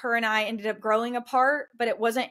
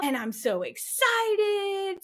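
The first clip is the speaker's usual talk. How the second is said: high, slow, gushing mock excitement; imitating excited friend; 9 semitones higher, much slower than usual. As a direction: stretch it out like a thrilled friend. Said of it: "She was super excited".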